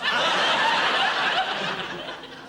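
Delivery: very high, loud guilty laugh; big laugh. Acted. Husband laughing at his own excuse to his wife. Short-arsed joke.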